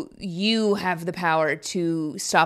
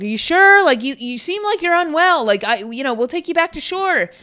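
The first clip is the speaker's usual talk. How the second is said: higher, lightly voiced worried helper; concerned rescuer; 8 semitones higher than usual. Second boater urging the drowning man, higher and worried. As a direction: coax him kindly into the boat.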